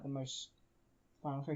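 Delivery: ordinary talk, nothing marked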